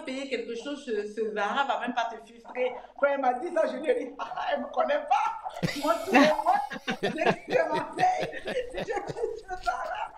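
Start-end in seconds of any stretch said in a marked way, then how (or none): 5.8-10.1 giggling through the words, pitched high and slowed; amused self-mocking confession; laughing. Real, not acted. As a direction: laugh at yourself as you admit it. Laughing at her own shopping habit.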